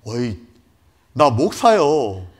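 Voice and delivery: loud, slow bold reply; defiant answer. Retold or imitated. Pastor facing the gun, loud and defiant. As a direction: own it proudly, slowly. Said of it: "나도 이렇게 합니다".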